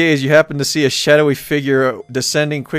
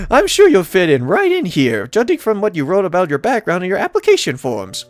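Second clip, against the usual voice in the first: high-pitched, welcoming lady mayor voice; pitched well up, pleased to see the newcomer; cheerful mayor; 5 semitones higher than usual. Acted. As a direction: voice a delighted mayor greeting a newcomer.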